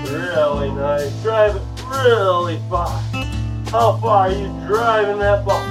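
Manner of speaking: ordinary delivery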